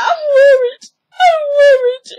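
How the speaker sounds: loud squeaky imitation of a fretting mom; mock tearful mother. Mother telling daughter she worries, mock high wail.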